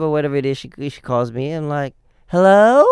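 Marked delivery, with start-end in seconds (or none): 2.3-2.9 higher, much louder dopey "Hello?"; acting stupid. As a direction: play dumb on the phone with a big goofy "Hello?". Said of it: "I act all stupid".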